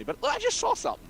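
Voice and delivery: high-pitched mock witness; timid witness. Wanted witness talking to police, high mock timidity. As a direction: pipe up like a reluctant informant.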